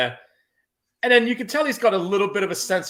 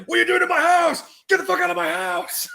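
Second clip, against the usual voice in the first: high, loud outraged yell; mock furious homeowner yell; angry parent shouting; 6 dB louder than usual. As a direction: bellow like a parent who caught intruders. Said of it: "they catch you and it's like".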